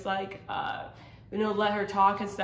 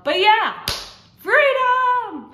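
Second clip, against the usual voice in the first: freedom, shouted much higher and louder; joyful shout; 12 semitones higher, 9 dB louder, much slower than usual. Real, not acted. Vlogger ending her testimony with a joyful shout. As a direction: cry it out in triumph. Joyful exclamation closing the story.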